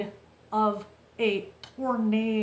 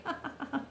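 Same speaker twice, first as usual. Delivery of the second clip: soft giggle at a fond memory; real, quiet chuckle mid-story; amused laugh; 4 semitones higher, 11 dB quieter than usual. Real, not acted. Laughing at husband's remembered remark.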